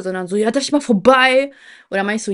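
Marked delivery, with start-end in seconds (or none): 0.4-1.5 imitated rude girl, loud and higher; antisocial. Mimicking the girl at the escalator, loudly antisocial. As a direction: demand passage with zero courtesy. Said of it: "Also so richtig asozial".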